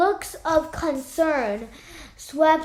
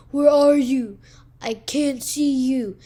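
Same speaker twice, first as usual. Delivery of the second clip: raised, louder frog calling through the reeds; croaking frog; 5 dB louder than usual. A narrator's reading. Frog calling for its unseen friend, croaking loudly. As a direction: call out like a lost frog. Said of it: "One would croak".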